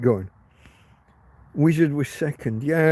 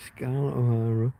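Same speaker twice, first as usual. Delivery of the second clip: ordinary talk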